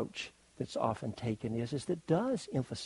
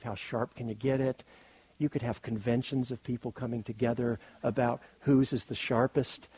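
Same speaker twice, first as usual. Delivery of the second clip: ordinary talk, nothing marked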